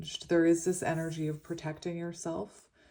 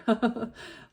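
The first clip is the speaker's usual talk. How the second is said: laugh; 5 semitones higher, 5 dB louder than usual. Real, not acted. Laugh after reading.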